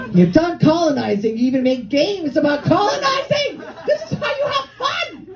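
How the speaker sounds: rising to a high incredulous shout; comic scandalized tirade; mock outrage. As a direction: build from protest to high-pitched yell. Mock outrage at rich cottagers.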